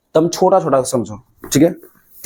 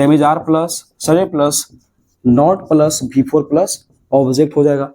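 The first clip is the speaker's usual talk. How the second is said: 1 slow dictation; slower than usual. Shown as a demonstration. Teacher spelling out formula slowly.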